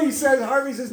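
normal talking voice

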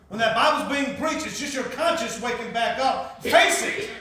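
loud, high, urgent exhortation; forceful preaching. Retold or imitated. Pastor confronting Dale about his conscience, forceful preaching.

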